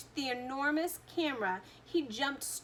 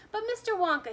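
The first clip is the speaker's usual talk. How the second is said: put-on high, loud boyish shout; 10 semitones higher, 7 dB louder, faster than usual. A narrator's reading. Boy shouting at the candy maker, a loud high call. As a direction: call out to grab Wonka's attention. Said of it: "he shouted".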